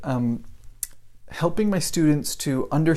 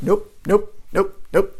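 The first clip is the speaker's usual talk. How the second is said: higher, short repeated dismissals; mock brisk rejection; 5 semitones higher, slower than usual. Mom checking kid's essay, briskly dismissive. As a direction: imitate mom striking out errors one by one.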